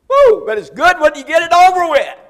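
high, loud whoop then shouted praise; exultant preaching cry; joyful whoop. Real, not acted. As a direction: let out a happy holler.

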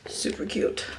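whispered admiration; hushed, pleased whisper; soft whisper. Real, not acted. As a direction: breathe it out quietly to yourself. Admiring hair clip.

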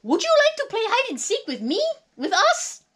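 high, loud puppet monster voice; Grover. Acted. Furry monster asks Molly to play, in high character voice. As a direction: pipe up with an eager invitation.

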